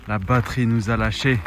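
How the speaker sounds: usual speaking voice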